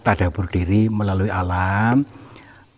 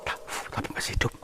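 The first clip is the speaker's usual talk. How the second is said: quick, very soft aside; nervous whisper; 4 dB quieter, much faster than usual. Real, not acted. Explorer sees the figure moving, nervous whisper. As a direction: whisper the discovery under your breath.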